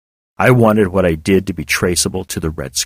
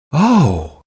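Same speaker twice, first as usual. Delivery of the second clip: amazed oh; 6 semitones higher than usual. A narrator's reading. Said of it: "I heard amazement in her voice".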